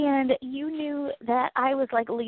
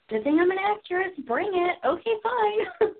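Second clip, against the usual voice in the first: mock brave crying; 7 semitones higher than usual. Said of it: "I'm like".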